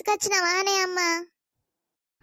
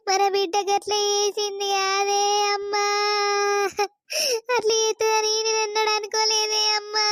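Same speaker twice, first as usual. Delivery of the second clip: tearful wailing. Acted. Mother laments her daughter to a visiting elder, wailing. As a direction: wail it out through sobs. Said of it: "ఇప్పుడు నువ్వు ఏడితే".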